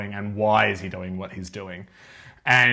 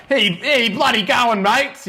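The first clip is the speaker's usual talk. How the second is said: high, exaggerated Aussie greeting; hammed-up broad Australian accent; 11 semitones higher, 4 dB louder, slower than usual. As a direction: imitate a genuinely over-the-top Australian. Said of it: "I actually know people who sound exactly like that".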